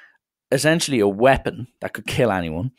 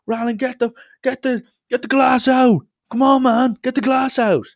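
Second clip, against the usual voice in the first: much higher and louder heckling crowd; mock queue shouting; 4 dB louder than usual. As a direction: jeer at him to get the glass out. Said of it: "starts screaming at me".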